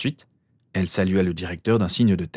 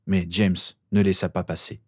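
ordinary delivery

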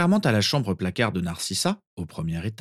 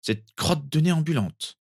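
put-on higher boy's voice, disdainful; sniffy disdain; 7 semitones higher than usual. A narrator's reading. Sirius settling into bed about Créature, sniffy. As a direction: sniff it like a scornful little boy. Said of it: "renifla Sirius".

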